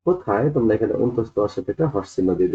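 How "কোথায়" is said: ordinary talk, nothing marked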